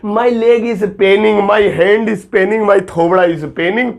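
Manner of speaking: very slow, deliberate demo of the wrong English; slow wrong example. Shown as a demonstration. Teacher slowly demonstrating the common Indian mistake. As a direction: drag out the mistake so students hear it. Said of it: "उसे अंग्रेजी में Penning बोलते हैं".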